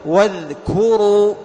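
loud, slow واذكروا, the ذ voiced against a whispered ك; loud teaching recitation of واذكروا; voiced dhal demo. Shown as a demonstration. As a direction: hold the ذ in واذكروا, then a crisp ك. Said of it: "الذال مجهور والكاف مهموس".

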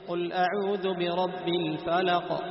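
slow, loud tajweed recitation; Quran recitation. Shown as a demonstration. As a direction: recite slowly with clear qalqala. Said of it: "لاحظ".

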